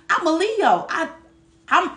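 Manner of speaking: ordinary talk, nothing marked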